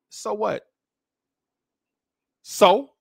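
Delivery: pointed, high comeback; dismissive retort. Acted. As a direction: brush off their anger, ending loud. Dismissive retort to mocked angry people.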